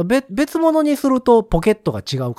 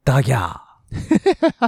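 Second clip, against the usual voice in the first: Nagoya dialect cry; 2 semitones lower, much slower than usual. Acted. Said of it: "地元のやつが出てしまった".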